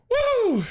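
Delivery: high loud whoop. Real, not acted. Streamer snapping out of laughing fit, whooping. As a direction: whoop to shake it off. Shaking it off.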